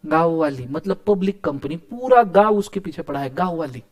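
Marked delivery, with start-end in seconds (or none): none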